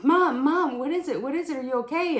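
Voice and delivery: high-pitched, urgent reenacted questions; excited. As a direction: press Mom eagerly, voice up high. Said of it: "'cause I'm just excited".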